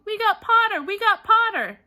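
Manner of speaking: loud cheering chant; twins yelling. A narrator's reading. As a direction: chant it across the hall in triumph. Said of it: "the Weasley twins yelled".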